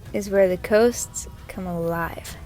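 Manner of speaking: normal talking voice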